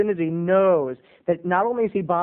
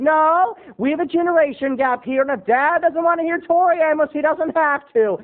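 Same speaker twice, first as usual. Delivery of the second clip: high, louder martyred whine; martyrish; 10 semitones higher, 5 dB louder than usual. Retold or imitated. Son playing martyr to his parents, high loud. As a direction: sulk like a suffering saint. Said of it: "I got really martyrish".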